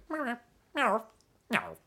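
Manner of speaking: high, thin mimicked meows; high put-on meowing; imitated cat meows. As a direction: do a small cat mewing. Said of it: "He's going".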